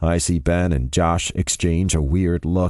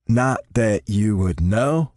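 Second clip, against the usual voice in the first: eye-roll sarcasm; slower than usual. A narrator's reading. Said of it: "Declan says with an eye roll".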